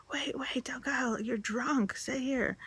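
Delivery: high, hushed imitation of worried pleading; mock pleading. Friends call after her as she runs out, mock pleading. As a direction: plead in a small, high put-on voice.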